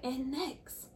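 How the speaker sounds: hammed-up awards host build; announcer voice. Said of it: "she's like".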